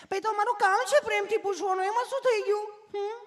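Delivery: very high, loud put-on nurse; sweetly asking nurse. Nurse explaining her job, high mock sweetness. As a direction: gush it high at the patient. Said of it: "તમે કેટલું પ્રેમથી પૂછો છો".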